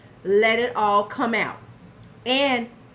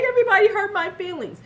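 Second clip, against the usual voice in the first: higher, whiny mock of hurt feelings; mock whiny complaint; 10 semitones higher, 5 dB louder, faster than usual. Mocking Tati whining that everyone hurt her feelings. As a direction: whine it like a wounded influencer. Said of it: "don't you come like".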